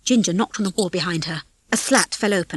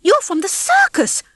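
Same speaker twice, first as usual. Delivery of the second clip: accusing; 12 semitones higher, 5 dB louder than usual. Acted. Accusing realisation.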